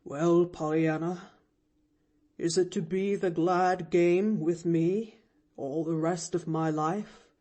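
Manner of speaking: a lightly voiced lonely old man, gently; gentle, tired older gentleman. A narrator's reading. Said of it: "asked the man gently".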